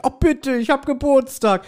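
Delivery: high, pleading lady voice; pleading woman. Retold or imitated. The woman pleads with the postman, high and pleading. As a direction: beg like the birthday woman.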